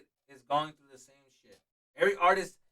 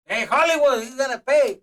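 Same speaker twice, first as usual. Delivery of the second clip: loud, high-pitched teasing dad; a mock Mexican dad calling him out; imitating his dad; 8 semitones higher, 12 dB louder, slower than usual.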